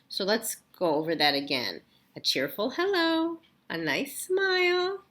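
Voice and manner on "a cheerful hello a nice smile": much higher, bright bouncing list; teacher reading the otter's friendly list, chirpy; cheery sing-song. Acted. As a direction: sell each friendly item with a big smile.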